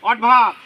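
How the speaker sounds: "অডভাব" with a lighter "অড" and a loud "ভাব"; stress on ভাব. Shown as a demonstration. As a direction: lean hard on "ভাব" in "অডভাব". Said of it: "অড আসতে ভাব ধরে বলো". ("অডভাব"-এর "ভাব"-এ জোর)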